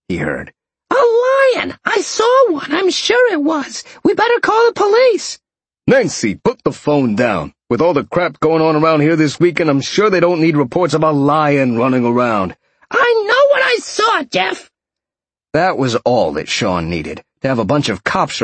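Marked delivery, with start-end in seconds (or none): 0.9-5.4 very high, loud falsetto woman; shrill, panicky insisting in a put-on woman's voice; alarmed woman. A narrator's reading. Nancy insisting she saw a lion, high alarmed voice. 5.9-12.6 mildly exasperated man, raised pitch. A narrator's reading. Jeff telling Nancy to put the phone down, mildly exasperated. 12.9-14.7 very high falsetto woman's voice; indignant woman. A narrator's reading. Nancy arguing with Jeff, high and indignant.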